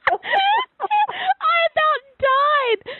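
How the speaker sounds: very high, stretched-out laughing squeal; real helpless laughter. Real, not acted. Host cracking up over the funniest voicemail, helpless laughing. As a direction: squeeze the words slowly through laughter.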